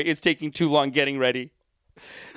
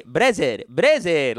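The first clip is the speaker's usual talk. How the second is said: put-on "brother" said as "Brazer" in a Lebanese Canadian accent; imitated Lebanese Canadian lilt, falling at the end; much slower than usual. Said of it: "with a Lebanese Canadian accent".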